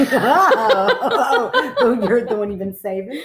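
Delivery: bright laughing squeals, high up. Real, not acted. Friend laughing at the long-awaited ghost story. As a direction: laugh through the words with delight. Excited about the other story.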